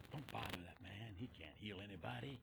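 low, soft grumble; complaining. Retold or imitated. Doubting leper complaining about Jesus, low grumbling. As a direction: grumble it under the voice. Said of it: "Peter was just complaining".

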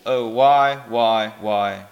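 low, sinking despair; defeated lament. A narrator's reading. Damned man regretting his foolishness, despairing.

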